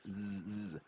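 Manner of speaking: isolated Z sound, softly held; buzzing Z. Shown as a demonstration. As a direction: buzz a long "zzz". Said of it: "a good Z sounding S".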